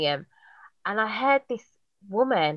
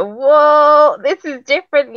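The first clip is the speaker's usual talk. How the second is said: amazed; 8 semitones higher, 9 dB louder, slower than usual. Retold or imitated. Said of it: "I was like".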